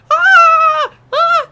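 piercing high shriek; high scream. Retold or imitated. Kid hit on the desk screaming high and loud.

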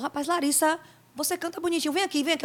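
a light imitation of the aunt calling her over; aunt coaxing. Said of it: "minha tia chegou pra mim e falou".

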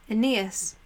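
usual speaking voice

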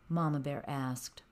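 usual speaking voice

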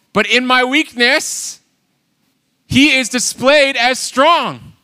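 slow, rising, forceful preaching line; slow emphatic preaching; preaching emphasis. Real, not acted. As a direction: preach it slowly with weight. Preaching climax.

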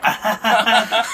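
loud slow drawn-out cackle; big laugh. Real, not acted. Co-host cracking up at their own show. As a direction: laugh it out long and high.

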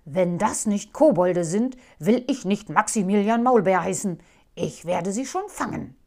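loud, determined outburst; loud grim resolve; determined vow. Acted. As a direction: swear to catch them. Angry husband vows revenge.

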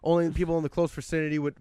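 usual speaking voice

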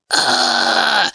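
low disgusted ugh; disgusted groan. Retold or imitated. Disgust at bad sex.

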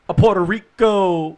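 loud, high, drawn-out call; slow, ringing mock fanfare; exuberant announcement. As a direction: belt it out like a hammed-up introduction. Hammed-up topic transition, called out.

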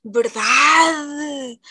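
loud, drawn-out agreement; strongly agreeing; emphatic agreement. Real, not acted.

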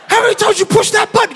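high, strained exasperated question; mock-exasperated husband; incredulous demand. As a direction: demand it in disbelief, pitch way up. Husband incredulous at wife in labour.

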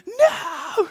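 high, loud mock scream of a scared child; imitated panicked scream. Stuck boy refusing his dad from the tree, mock panicked scream. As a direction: cry it out high, acting the freaked-out kid. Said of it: "I'm screaming".

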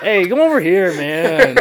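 high, loud singsong whine; whiny Korean intonation. Retold or imitated. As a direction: whine like a four-year-old girl. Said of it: "super whiny, like a little, like, four-year-old girl".

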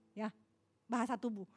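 usual speaking voice

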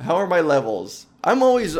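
ordinary delivery

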